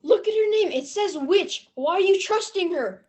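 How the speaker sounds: normal talking voice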